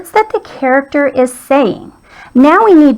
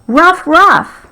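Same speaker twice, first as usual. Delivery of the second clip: lightly voiced dog bark, higher pitched; 6 semitones higher than usual. Teacher voicing the dog's speech bubble, light barking. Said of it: "that's what she says".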